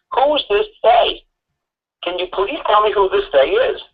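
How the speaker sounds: high-pitched exasperated pleading; put-on incredulous questioning; exasperated demand. Mock pleading with the lawyer for answer.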